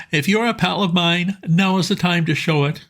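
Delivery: pleading pal, keen and urgent; eager Bingo. A narrator's reading. Bingo asking his old friend Bertie for help, eager and high. Bingo voiced higher throughout.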